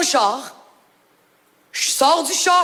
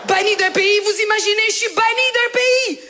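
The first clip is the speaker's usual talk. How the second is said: high, rising outraged rant; scandalized, voice pitched well up; comic indignation; 6 semitones higher than usual. Acted. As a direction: build to an incredulous protest. Rant about being banned.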